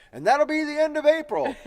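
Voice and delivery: bright, high, confident announcement; high quoting voice. Retold or imitated. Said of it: "I think I said".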